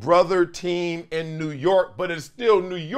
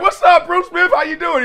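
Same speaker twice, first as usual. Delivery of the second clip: excited greeting; 13 semitones higher, 7 dB louder than usual. Retold or imitated. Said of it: "I was like".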